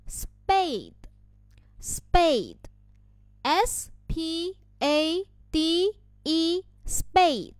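spelling out letter by letter, high and slow; 逐字母拼读. Shown as a demonstration. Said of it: "看一下它的这一个拼读". (一个字母一个字母地慢速高声拼读)

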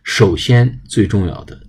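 usual speaking voice

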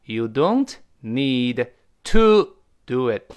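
word by word with TO stressed loudly; drawn-out teaching repeat, spaced words; slow emphatic model. Shown as a demonstration. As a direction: spell it out slowly, hitting TO. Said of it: "注意".

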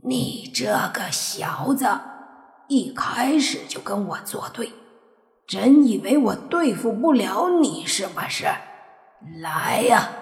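menacing bony creature taunt; skeleton shouting. Acted. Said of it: "只听他口里大喝".

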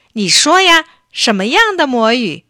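put-on eager boy's high, loud urging; impatient. A narrator's reading. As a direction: demand it like a child who can't wait. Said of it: "巴夫利克着急着说". (着急地高声催问)

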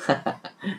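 a quiet rising chuckle; soft laugh. Real, not acted. Storyteller amused at the ending, soft chuckle.

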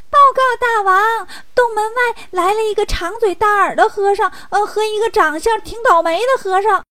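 high-pitched minion voice; squeaky little imp reporting in haste; little demon, alarmed report. Acted.

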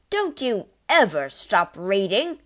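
loud, sharp father's snap; father snapping at his reading daughter; angry snap. A narrator's reading. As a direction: snap at the girl in irritation. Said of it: "he snapped at her".

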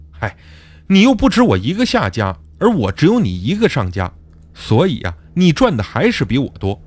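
plain reading voice

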